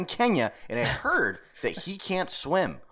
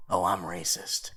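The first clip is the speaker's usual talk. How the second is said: low, slow, flat mock confession; deadpan confession; 9 semitones lower, 5 dB quieter, slower than usual. Mock admitting to his cohost, deadpan and low. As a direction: drop it dry and slow.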